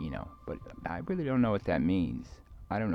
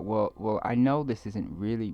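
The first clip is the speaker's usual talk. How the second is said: normal talking voice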